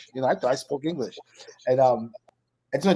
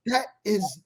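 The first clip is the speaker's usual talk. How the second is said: high-pitched imitation of a Welsh accent; funny Welsh accent; 9 semitones higher than usual. Welsh girl starts correcting her friend, funny Welsh accent. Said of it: "the way she said it was so funny".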